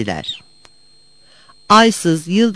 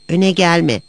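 ordinary delivery, nothing marked

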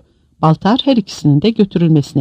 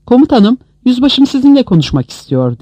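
higher, urgent report to a superior; excited; 9 semitones higher, 4 dB louder than usual. A narrator's reading. Soldier hands his commander the radio, excited. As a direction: excitedly pass on the message. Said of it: "Telsizi heyecanla Baltar'a uzatarak".

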